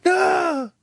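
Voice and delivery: high laughing protest. Real, not acted. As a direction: squeal it through laughter. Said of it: "doing your soldier voice".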